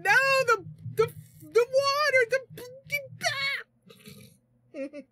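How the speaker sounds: high, loud, stammering mock panic. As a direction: imitate someone frantic, tripping over the words. Imitates someone frantically typing warnings.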